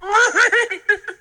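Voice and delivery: high squeaky giggle, fading; a tiny creature's tickled giggle; Yoda giggle. Acted.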